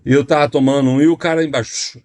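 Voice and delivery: ordinary talk, nothing marked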